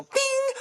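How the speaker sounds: imitated phone notification, sung high; high alert ping. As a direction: chime a sudden high bing.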